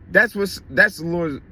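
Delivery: ordinary talk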